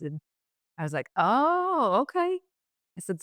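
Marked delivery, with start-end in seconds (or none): 1.2-2.4 high, sliding surprised oh okay; a startled little oh as it sinks in. Retold or imitated. To the SEAL she just teased, high startled surprise. Caught off guard by his answer.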